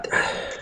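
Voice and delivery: soft, tired breath out; weary sigh. Real, not acted. Streamer worn out by the feud, weary sigh. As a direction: sigh it all away.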